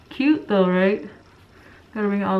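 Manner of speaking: muffled behind cloth mask; speaking with mouth covered; through a face mask. Real, not acted. Seen 2: Polka-dot cloth mask covers mouth and nose until pulled down ~7.3.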